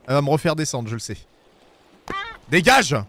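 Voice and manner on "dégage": dégage crié. Real, not acted. Chasse encore l'oiseau.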